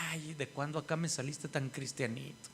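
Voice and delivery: mock dismissiveness, pitch lifting toward the end; burla desdeñosa. Familiares incrédulos se burlan del creyente. (imitando una burla desdeñosa)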